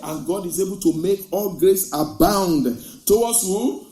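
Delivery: loud, proclaiming Bible verse; scripture reading. Real, not acted. Preacher reading Corinthians to the church, loud and proclaiming. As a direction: read it out like a proclamation.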